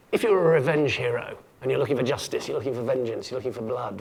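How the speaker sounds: ordinary delivery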